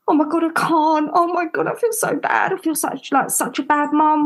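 high, breathless panic, half whispered; panicking. Retold or imitated. Mum in her bedroom panicking over shame, high and breathless. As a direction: gasp out the guilt in a rush. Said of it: "I was having a panic attack".

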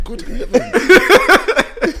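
drawn-out mock gasp. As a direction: draw out heavens like a shocked toff. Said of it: "imagine the people saying".

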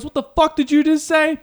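high, put-on tough guy; a startled athlete snapping back; mock angry player. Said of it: "He goes".

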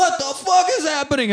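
high, startled shout; sudden ambush panic; mock terror under arrows; panicked cry.

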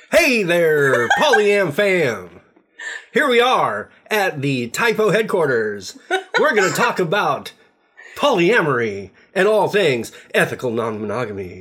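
high, loud, bubbly intro redo; practicing a speech. Shown as a demonstration. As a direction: pump up the energy, big and bright. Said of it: "Okay, let me try it again".

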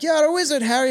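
put-on British child quoting a film line; little British boy. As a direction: imitate a British boy's accent. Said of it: "A little British boy".

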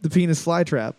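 usual speaking voice

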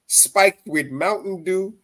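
normal talking voice

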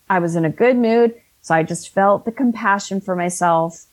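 normal talking voice

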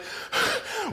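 high, breathless gasp; panicked gasp. Acted. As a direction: suck in air as if winded by panic. Herders arriving in panic.

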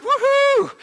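very high happy whoop; jubilant whoop. Retold or imitated. Speaker cheering the engineer's permission, high gleeful whoop.

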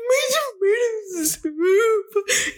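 very slow, high-pitched mock bawl; hammed-up sobbing. Crying to mom over spilled soup, hammed-up sobbing. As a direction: wail it through fake sobs. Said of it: "a full mental breakdown".

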